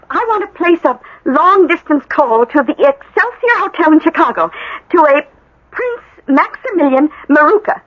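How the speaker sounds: ordinary talk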